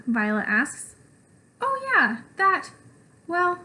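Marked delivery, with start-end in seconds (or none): 1.6-3.6 flustered. Acted. Caught off guard.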